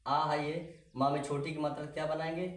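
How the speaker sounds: ordinary delivery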